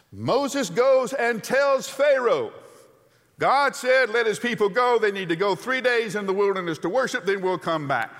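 high, loud preacher's build; preaching at full force. Acted. Preacher telling Pharaoh's answer at full force. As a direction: drive the story home to the congregation.

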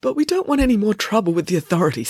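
high, worried plea; nervous. Acted. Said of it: "glancing nervously".

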